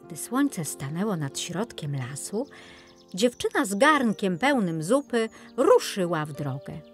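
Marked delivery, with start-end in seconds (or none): none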